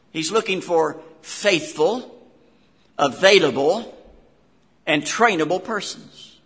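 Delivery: preacher pounding out faithful, available, trainable; emphatic list. Real, not acted. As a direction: drive home every word of the list. Preacher listing qualities emphatically.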